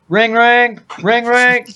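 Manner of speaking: high, bright-pitched ring-ring; spoken telephone ringing imitation; imitated phone ring. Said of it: "So I picked up my phone".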